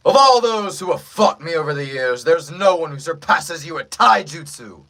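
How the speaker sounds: big theatrical anime villain delivery; villain in battle. Acted. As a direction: proclaim it to a worthy foe. Performs villain's line for audio test.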